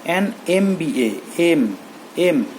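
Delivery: ᱮᱱ ᱮᱢ ᱵᱤ ᱮ spelt slowly, ᱮᱢ repeated; spelling out letters. Shown as a demonstration. Teacher spelling out ᱮᱢ for the class, slow and clear. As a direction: slowly say each letter name. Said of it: "ᱮ ᱦᱤᱡᱩᱜ ᱠᱟᱱᱟ".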